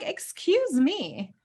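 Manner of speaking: slow, high, affronted excuse me; a slow offended comeback; indignant retort. Retold or imitated. Teased dancer answering classmates, slow and indignant. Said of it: "And I'm like".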